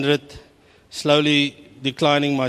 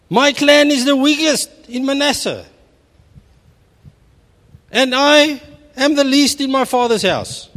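high, pleading Gideon voice, pitched well up; pitched-up wail of self-pity; high whiny voice; 8 semitones higher than usual. Acted. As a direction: whine it up high like a frightened little man. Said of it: "that was a high pitch".

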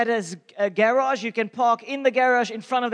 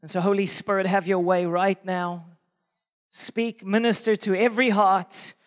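prayer; 3 semitones lower than usual. Acted. Pastor praying over the church, hushed and earnest. Opening prayer before sermon.

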